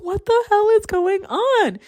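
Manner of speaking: high, slow bewildered question; confused. Retold or imitated. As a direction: sound utterly baffled. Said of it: "confused reaction to hang-up".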